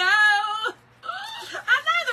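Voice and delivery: loud, high squeal with a laugh in it; gleeful squeal. Real, not acted. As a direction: squeal in real, giddy agreement. Overcome with joy about the earrings.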